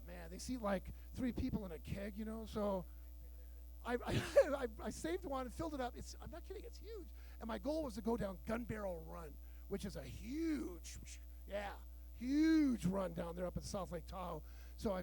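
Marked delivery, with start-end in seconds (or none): none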